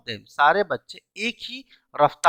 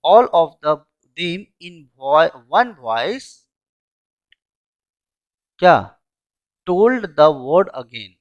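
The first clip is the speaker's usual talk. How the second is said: all of them in one voice told the word again, said very slowly and spelled out word by word; slow model sentence; 6 dB louder, much slower than usual. Shown as a demonstration. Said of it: "मैं बोलूंगा". (शब्द-शब्द धीरे बोलकर)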